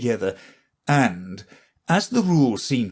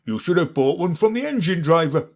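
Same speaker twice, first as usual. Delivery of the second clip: guard's voice; 6 semitones higher, faster than usual. A narrator's reading. The guard speaking to Alice.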